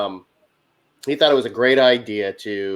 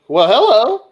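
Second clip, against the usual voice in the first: taken aback; 9 semitones higher, 5 dB louder than usual. Retold or imitated. Teller reacts to the outburst.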